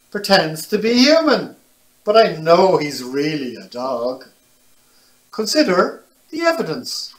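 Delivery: put-on lively, animated read to children; picture book reading. A narrator's reading. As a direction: tell it brightly to little listeners.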